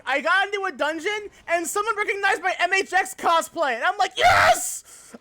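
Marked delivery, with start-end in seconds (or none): 4.2-5.1 high, loud joyful shout; happy yell. Retold or imitated. Streamer reliving being recognized in cosplay, joyful shout. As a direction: burst out with delight. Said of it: "I was so happy".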